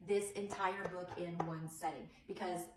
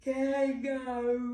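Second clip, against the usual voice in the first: slow louder yawn; kangaroo yawn; 4 semitones higher, 5 dB louder, much slower than usual. Shown as a demonstration. Teacher yawning kangaroo with the children. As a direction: stretch the word into a yawn. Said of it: "Let's do".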